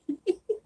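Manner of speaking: high, soft giggle; real, light cracking up; laugh. Real, not acted. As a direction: giggle at your own hammed-up voice. Cracks up at own character voice.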